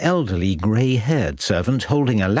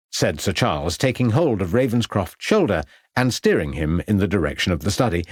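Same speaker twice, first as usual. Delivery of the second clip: usual speaking voice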